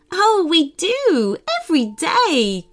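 grin through it and stretch it out; grinning. A narrator's reading. Mermaid telling a shy unicorn about their games, grinning. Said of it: "grinned the mermaid".

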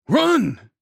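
high, full-force shout. A narrator's reading. Hernandez warning others on the stairs, shouting. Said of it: "Hernandez shouted".

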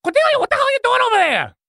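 fast, very high-pitched holler; exasperated possessed hunter. Acted. Distant possessed witch hunter hollers at Cordelia, exasperated. Possessor shouts from far away.

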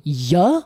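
ya as a drawn-out sample sound. Shown as a demonstration. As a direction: sound out ya with a y glide, slowly, questioning. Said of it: "what is a J in Spanish".

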